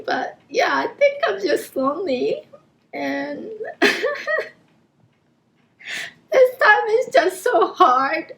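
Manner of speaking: high, tearful confession of loneliness; crying. Real, not acted. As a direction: struggle to talk through real tears. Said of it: "I don't know why I'm crying".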